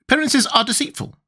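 high, earnest, learned creature; earnest Wogglebug. A narrator's reading. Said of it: "said the Wogglebug earnestly".

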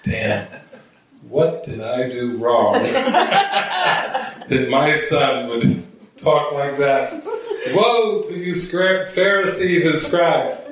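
mock exasperated mother, laughing through it; Mary laughing. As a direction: play Mary, giggling and slow. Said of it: "Mary's probably going".

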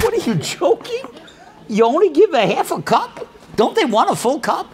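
high, incredulous laughing question; laughing disbelief. Retold or imitated. Son amazed, laughing at his father's half cups. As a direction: laugh at the idea of giving only half.

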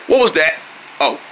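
usual speaking voice

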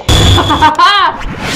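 real loud laughing; gleeful laugh. Real, not acted. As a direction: cackle at finding your prey. Found the boy.

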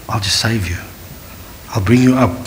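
soft, low reassuring voice; Jesus gently rescuing Peter; gentle reassurance. Pretend. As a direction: calmly promise to lift him.

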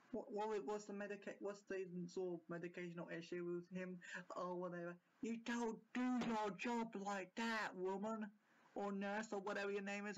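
ordinary talk, nothing marked

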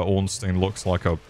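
ordinary delivery

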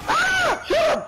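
high panicked shriek, then shout; panicking gamer. Real, not acted. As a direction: scream in sudden panic. Ambushed during firefight.

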